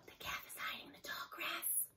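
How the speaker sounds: soft, hushed wolf whisper; a wolf leader whispering to his pack. A narrator's reading. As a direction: whisper so the prey won't hear. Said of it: "whispered Shadow".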